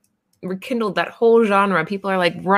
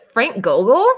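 ordinary talk

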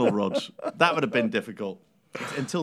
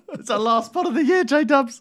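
mock delight; 11 semitones higher, 3 dB louder than usual. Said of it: "I'm like".

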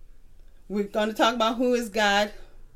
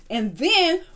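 ordinary talk, nothing marked

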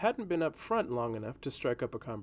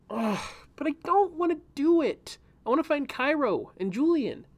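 high, louder whining protest; a young girl groaning that she won't do it; whiny girl; 13 semitones higher, 3 dB louder than usual. A narrator's reading. Reyna protesting to Kadok, high loud whine. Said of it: "Reyna threw her head back".

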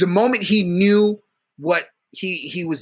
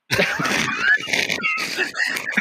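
a very high, screaming burst of laughter. Real, not acted. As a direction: explode into a wild cackling laugh. Big laugh after the joke.

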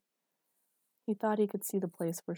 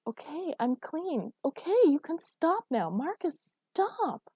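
high, distressed begging; drawn-out, high-pitched protest; urgent plea; 10 semitones higher, 6 dB louder, slower than usual. A narrator's reading. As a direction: plead for it to end, climbing higher. Pleading with him to stop.

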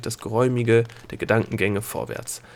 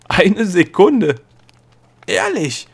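loud, high, drawn-out amazement; astonished; 9 semitones higher, 8 dB louder, slower than usual. A narrator's reading. Girl learning only a second has passed, astonished. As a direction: exclaim it slowly in high-pitched disbelief. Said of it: "staunte Delia".

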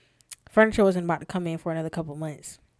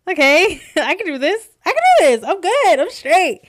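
higher, louder hyped-up self reassurance; frantic self pep talk; 9 semitones higher, 12 dB louder than usual. Retold or imitated. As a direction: talk yourself into it, half laughing.

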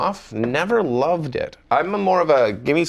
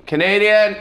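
loud, high yelled call; director's yell; 9 semitones higher, 6 dB louder than usual. Retold or imitated. Director yells at the actor on set, a loud imitated yell. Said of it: "you will always hear the director yell".

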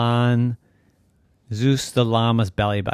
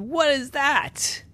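high, stretched-out amazed question; startled; slower than usual. Acted. Llama sees a lightning bolt, startled. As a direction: squeal it in alarm.

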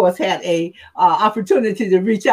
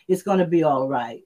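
soothing reassurance; 6 semitones lower, 4 dB quieter than usual. Shown as a demonstration. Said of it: "Hear it in whatever voice you hear it in".

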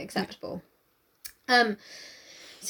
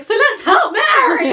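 high, loud, drawn-out cry; acted rallying shout; 11 semitones higher, 10 dB louder, slower than usual. Wife urging Darcy to help, loud high shout. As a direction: shout it slowly like a rallying call. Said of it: "And she's like".

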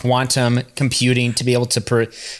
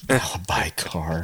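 whispered aside; 8 semitones lower, 7 dB quieter, slower than usual. Real, not acted. Repeating joke under breath.

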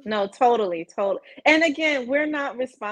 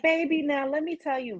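higher-pitched, kindly church-lady address; sweet, not biting; 5 semitones higher, 4 dB quieter than usual. Shown as a demonstration. Older woman advising someone younger, sweetly. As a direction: coax gently in a raised pitch. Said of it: "versus a more, you know, the kind of biting".

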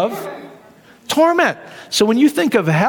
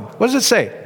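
ordinary delivery

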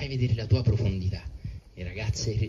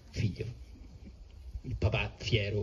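ordinary delivery

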